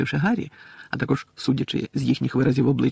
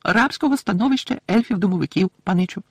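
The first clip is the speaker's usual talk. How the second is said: high, loud elf voice; solemn little elf explaining in a high pipe; earnest, high-pitched servant elf; Dobby the house-elf, solemn; 10 semitones higher, 5 dB louder than usual. Acted.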